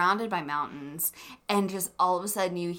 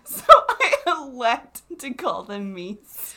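laughing; 10 semitones higher, 8 dB louder, slower than usual. Real, not acted. Repeats claim while laughing.

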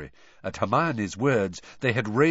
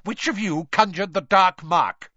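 loud, raised, angry interrogation; snapped; 6 semitones higher, 7 dB louder than usual. Acted. Mister Crouch, taut with rage, to children, snapped. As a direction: demand a culprit angrily. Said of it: "he snapped".

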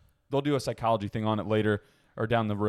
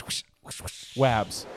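whooshing sound effect; slower than usual. Pretend. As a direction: mimic a transition swoosh. Imitated transition sound.

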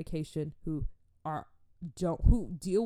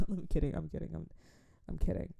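usual speaking voice

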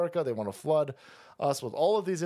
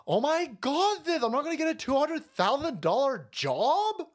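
mocking, much higher whiny girl voice; whiny college grad; 8 semitones higher than usual. Mocking the woman from the clip, high whiny parody. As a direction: sneer it like a spoiled grad crying for her big job. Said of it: "And she sits there and go".

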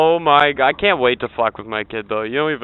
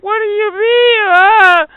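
put-on much higher wailing child; crying kid; 6 dB louder, slower than usual. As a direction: kid bawling in mock despair. Kid learns Santa isn't real.

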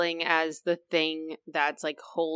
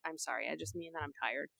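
much softer, sheepish and small; meek apology; 2 semitones lower, 14 dB quieter, faster than usual. Retold or imitated. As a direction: speaker apologizing to her sister, sheepish and soft. Apologizing to horrified sister.

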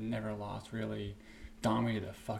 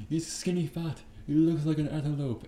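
loud, higher mocking insult; mock Conor trash talk; 5 semitones higher, 8 dB louder than usual. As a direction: taunt him like a cocky trash talker. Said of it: "Fucking Conor McGregor".

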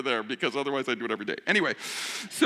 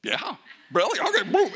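put-on eager kid; 10 semitones higher, 5 dB louder than usual. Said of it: "Everett, you want this donut?".

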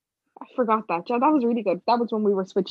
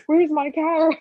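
shocked question; 8 semitones higher, 4 dB louder, slower than usual. Retold or imitated.

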